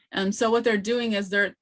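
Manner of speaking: ordinary delivery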